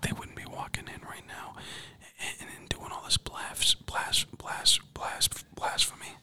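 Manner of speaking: stuttering, exasperated whisper; under-the-breath stammered gripe; stammering whisper. Real, not acted. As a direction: whisper it, tripping over the word blasphemy. Whispering complaint about people walking in.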